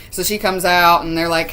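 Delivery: usual speaking voice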